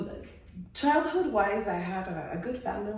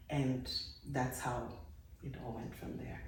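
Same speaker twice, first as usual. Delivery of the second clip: fading; 4 semitones lower, 8 dB quieter than usual. Real, not acted. Recalling abuse, voice fades.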